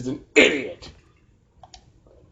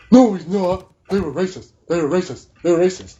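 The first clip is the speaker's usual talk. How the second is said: raised-pitch whiny objector impression; mock protest; 3 semitones higher than usual. Mocking objectors who keep repeating themselves, mock protest. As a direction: parrot the objection in quick repeats.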